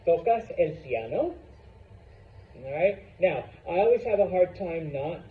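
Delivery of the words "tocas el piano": "tocas el piano?" rising sharply on "piano"; question intonation. Shown as a demonstration. Teacher asking Seth as a demonstration, rising question tune. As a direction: swing the end of "piano" upward. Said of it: "There is a rising pitch towards the end".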